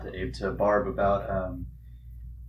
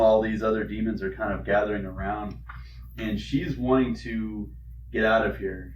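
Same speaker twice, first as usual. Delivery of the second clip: usual speaking voice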